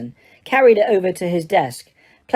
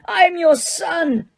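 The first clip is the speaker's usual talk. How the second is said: much higher, slow anguished cry; anguished scream; 11 semitones higher, slower than usual. Acted. Son screaming at his father, slowed anguish. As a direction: plead to be claimed.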